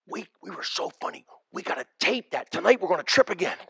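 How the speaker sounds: very soft acted quote; hushed proposal. Friend at the club proposing to tape it, hushed.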